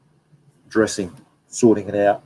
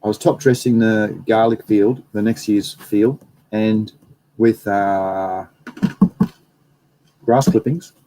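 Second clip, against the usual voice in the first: ordinary delivery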